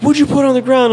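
retold high-pitched interrogation; demanding cop. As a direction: imitate an agitated cop demanding an answer.

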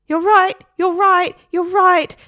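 high, loud frantic agreement; a nervous parrot agreeing with its owner, high and loud. A narrator's reading. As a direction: gabble it out nervously.

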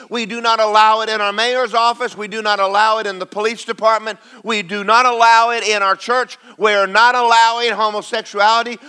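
preacher leading a bold prayer; declaring prayer. Shown as a demonstration. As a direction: pray it with authority. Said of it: "get on your knees and say".

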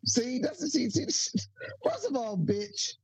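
high, giggly comeback; giggling. Real, not acted. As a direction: laugh through the comeback. Stoned laughing retort.

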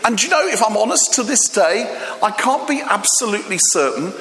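excited storyteller. Retold or imitated.

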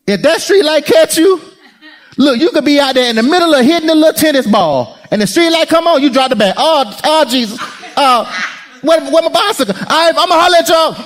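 put-on a kid rushing home in a panic; panicked kid. Retold kid panicking at streetlight.

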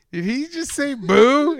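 slow, high incredulous question; imitating a baffled brother; mock disbelief. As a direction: ask it stunned, pitch swooping up. Quoting his incredulous brother.

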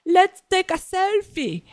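high, breezy brush-off, slowed on the selfie; dismissive storyteller. Retold or imitated. Mimicking the storyteller brushing off a fan, high and breezy. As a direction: toss it off like he barely noticed. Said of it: "And he just goes".

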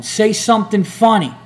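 high-pitched imitated heckle; heckler's call. Heckler to the comic on stage, mock taunting shout.

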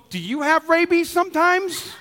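put-on high-pitched little-kid question; five-year-old boy. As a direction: imitate a small child asking, pitch lifted. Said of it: "my five-year-old son goes".

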